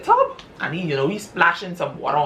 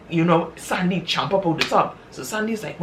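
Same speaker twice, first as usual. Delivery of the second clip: ordinary delivery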